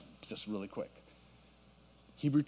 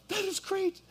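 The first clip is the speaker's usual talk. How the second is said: excited. Retold or imitated. Retelling meeting brother's friend.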